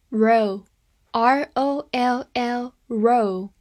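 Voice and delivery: very slow letter-by-letter spelling; drawn-out dictation; spelled out slowly. Shown as a demonstration. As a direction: spell each letter out for the class. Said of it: "spelling out the word".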